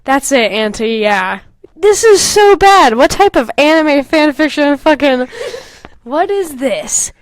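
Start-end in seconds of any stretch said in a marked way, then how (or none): 1.8-7.1 mock despair, high and loud; exasperated. Real, not acted. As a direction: groan it out at the cringe. Exasperated reaction to bad fanfic.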